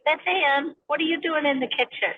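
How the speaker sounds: a mother catching her daughter in the kitchen, scolding; mom scolding a child. Retold or imitated. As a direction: call out to a kid sneaking food. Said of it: "I'm like".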